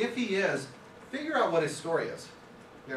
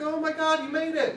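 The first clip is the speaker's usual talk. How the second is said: high, louder cartoon astonishment; amazed frogs; 12 semitones higher, 5 dB louder than usual. Frogs watching him hop out of the bucket, high amazed voices. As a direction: gasp it out like stunned little frogs. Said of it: "the frogs are like".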